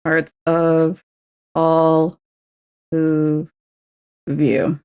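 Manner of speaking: slow, separated words; slow careful recount; word-by-word count. Shown as a demonstration. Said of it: "There we go".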